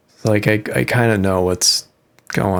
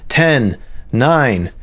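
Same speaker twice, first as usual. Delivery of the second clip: slow, dramatic countdown; launch countdown; 4 semitones higher, much slower than usual. Pretend. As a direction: count down like mission control.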